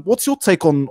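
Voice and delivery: ordinary talk, nothing marked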